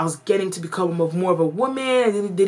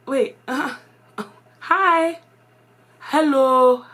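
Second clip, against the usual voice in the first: hesitant hello in a put-on Ghanaian accent, higher; 8 semitones higher, 3 dB louder, much slower than usual. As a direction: greet stiffly with a Ghanaian accent. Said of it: "my accent had changed".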